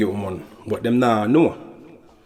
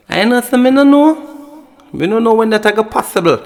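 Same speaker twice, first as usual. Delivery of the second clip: put-on girlfriend's phone voice; 10 semitones higher than usual. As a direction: imitate the girl playing innocent on the phone. But you not hear female voice.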